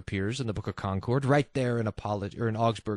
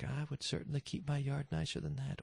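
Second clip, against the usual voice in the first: snooty neighbor; 12 dB quieter than usual. Retold or imitated. Mocking a judgy neighbor.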